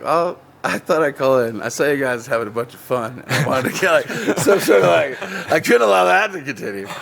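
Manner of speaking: a fake caller confessing he came to spoil it, laughing; mock caller voice.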